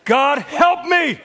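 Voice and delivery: mock furious prayer, half laughing; angry cry to God. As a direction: cry out to God through gritted teeth. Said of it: "It's a cry because I'm angry".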